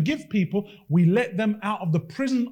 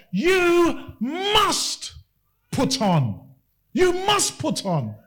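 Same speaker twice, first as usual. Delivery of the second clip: fiery preaching; 5 semitones higher, slower than usual. Acted. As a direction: preach it with rising fervour. Preaching at full force.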